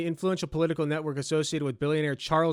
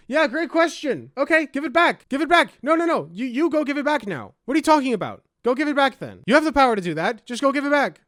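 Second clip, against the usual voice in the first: higher, louder exasperated scolding; raised indignant voice demanding he return it; sarcastic angry rant; 7 semitones higher, 5 dB louder than usual. Real, not acted. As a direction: tell him off, fed up and mocking. Ranting at billionaire's complaint.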